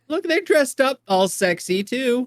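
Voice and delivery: a smug husband showing off his own outfit; mock husband. Husband points at other dads' outfits, high and slow. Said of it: "Also the husbands".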